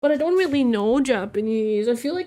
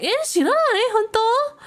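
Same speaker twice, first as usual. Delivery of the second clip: much higher, mock-shocked question; shocked disbelief; 13 semitones higher, 4 dB louder than usual. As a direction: act stunned she has never heard. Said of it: "And I was like".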